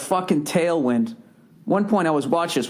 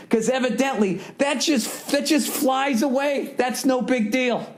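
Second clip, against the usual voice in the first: heavy sarcasm; 9 semitones higher than usual. Real, not acted. Sarcastic jab at doping scandal.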